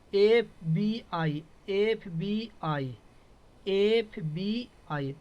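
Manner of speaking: slow, separated letters in FBI; deliberate teacher's spelling of एफ़ बी आई. Shown as a demonstration. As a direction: spell out FBI slowly, each letter on its own. Teacher sounds out an abbreviation.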